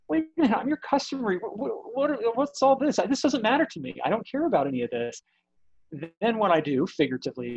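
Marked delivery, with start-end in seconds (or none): 0.1-5.2 higher, fast, indignant customer voice; a baffled customer pushing back, pitched up. Retold or imitated. Imagined customer challenges the executive's busywork, higher and indignant.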